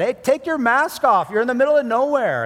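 high, loud yell at a stranger; angry shout out the car window. Retold or imitated. Preacher to masked man, loud angry yell. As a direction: snap at him through the window. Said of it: "I said".

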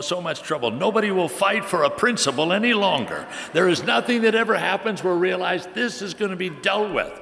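high, loud mock-patriotic tirade; a man lecturing his wife about fighting for principle, hammed-up and loud; mock heated rant. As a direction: ham up a fired-up speech about principle. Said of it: "speaker's own rant retold".

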